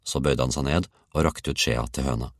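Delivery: usual speaking voice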